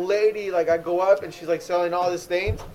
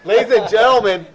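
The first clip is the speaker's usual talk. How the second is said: announcer voice; 4 semitones higher, 8 dB louder than usual. Pretend. Announcing Timmy's arrival.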